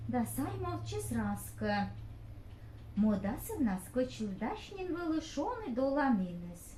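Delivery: normal talking voice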